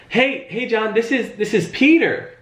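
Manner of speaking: high, bright-pitched greeting with a falling end on Peter; familiar friendly greeting. Shown as a demonstration. Sales trainer demonstrating a familiar phone intro, high and upbeat. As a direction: greet him like a long-lost best friend. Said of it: "let me try it again".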